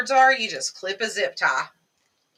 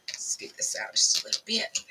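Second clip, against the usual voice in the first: whispering; 6 dB quieter than usual. Real, not acted. Quiet aside while crafting.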